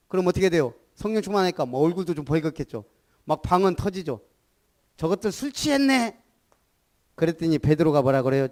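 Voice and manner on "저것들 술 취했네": lightly voiced jeer, pitched higher; a hint of a mocking onlooker; scoffing bystander. Onlooker jeers the believers are drunk, light mock sneer. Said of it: "사람들이 뭐라 그랬어요?".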